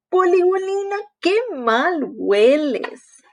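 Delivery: slow, high teasing; teasing disgust. Acted. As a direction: scold the stinky baby. Froggy huele el pañal. (burla asqueada y lenta)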